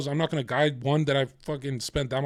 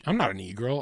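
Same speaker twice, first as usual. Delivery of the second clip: normal talking voice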